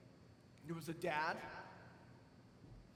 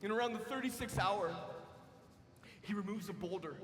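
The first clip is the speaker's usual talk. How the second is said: ordinary delivery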